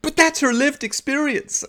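very high, hammed-up indignant plea; mock upset protest. Debater defends her feelings, mock upset protest. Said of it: "got really annoyed and angry and upset".

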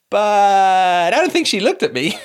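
high, loud, drawn-out protest; mock coach's excuse. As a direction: stretch the opening word into a comic whine. Coach's excuse acted out mockingly.

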